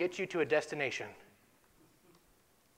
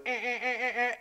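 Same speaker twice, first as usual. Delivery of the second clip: higher, clipped beeping eh sounds; imitated alarm beep; 7 semitones higher than usual. Telling listeners about the cockpit alarm, higher beeping imitation. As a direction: mimic a warning light's beeps.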